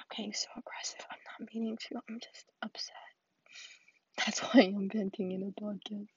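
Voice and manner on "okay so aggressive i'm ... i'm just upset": much softer, almost under the breath; subdued self-correction. Real, not acted. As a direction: back off quietly after a sigh. Sighs, backs off from her rant.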